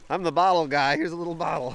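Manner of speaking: playful silly guy voice; goofy voice. Retold or imitated. Voicing Rob finding a bottle.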